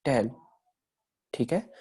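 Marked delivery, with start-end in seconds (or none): 0.0-0.3 टेल said carefully, with the ह folded into the vowel; pronunciation demo. Shown as a demonstration. टेल as a softened vowel, no separate ह. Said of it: "कुछ इस प्रकार से".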